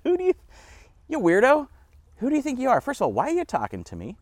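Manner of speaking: high, loud indignant woman; mock incredulous. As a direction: challenge him in hammed-up disbelief. Acts out the woman's incredulous reply.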